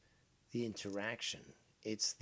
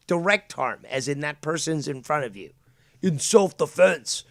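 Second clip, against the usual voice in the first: loud and higher, stretching out self-defense in disbelief; angry shouting; 4 semitones higher, 8 dB louder than usual. Real, not acted. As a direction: blast the word back at him in outrage. Furious rebuttal in argument.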